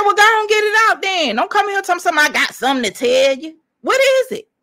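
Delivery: high, fast, loud telling-off; exasperated rapid scolding; impatient loud demand. Retold or imitated. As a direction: demand it out of him, high and sharp. Retold demand to husband.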